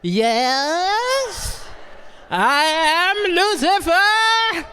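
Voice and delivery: loud, drawn-out devil voice rising in pitch; best devil voice. Acted. Boy playing along with his exorcism, hammed-up devil voice. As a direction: play the devil, slow and grandiose. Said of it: "I put on my best devil voice".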